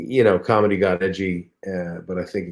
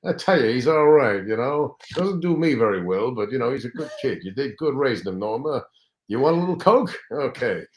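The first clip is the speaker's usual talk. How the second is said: raised, louder gravelly-free comic voice of a showbiz star; Rodney impression; 6 semitones higher, 6 dB louder than usual. Comedian praising son to his mother, hammed-up star voice. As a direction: ham up the big-shot comic schmoozing a mother. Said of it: "And he's going".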